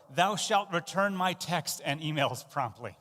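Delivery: a hint of a lawgiver; lightly hammed-up decree; mock commandment. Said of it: "we might say it like this".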